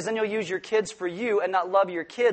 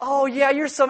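gushing wife; 7 semitones higher, 3 dB louder than usual. Pretend. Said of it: "and then go".

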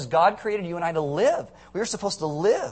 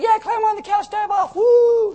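high, loud mock cheering of a fun-loving aunt; excited aunt; 7 dB louder than usual. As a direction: egg the kids on like a wild wrestling fan. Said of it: "She'd be like".

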